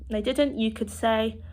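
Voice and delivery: ordinary talk, nothing marked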